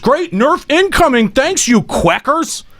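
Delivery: high, slow mocking read of chat. Shown as a demonstration. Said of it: "in the chat saying".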